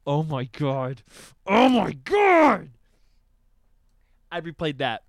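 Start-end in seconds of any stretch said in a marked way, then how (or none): 0.1-1.0 low-pitched stunned "oh my god"; contained amazement. Retold or imitated. Host headbanging to the song, low awed exclamation. 1.5-2.7 high, loud, slow yell; exploding amazement. Retold or imitated. Host headbanging to the song, ecstatic drawn-out shout. Second exclamation, built to a yell.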